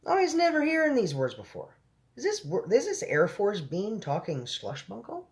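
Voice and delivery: high-pitched baffled giant, slowing at the end; puzzled giant. A narrator's reading.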